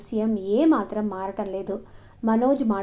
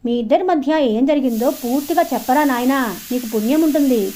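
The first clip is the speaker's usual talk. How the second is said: louder, insistent coaxing questions; imploring, lifted and emphatic; pleading; 4 semitones higher, 6 dB louder than usual. A narrator's reading. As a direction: beg to be told everything. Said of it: "బతిమలాడుతున్నట్లుగా అడిగాడు".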